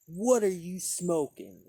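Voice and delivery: retelling a woman confronting a pipe smoker; accusing lady. Retold or imitated. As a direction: demand it like an offended stranger. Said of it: "she goes".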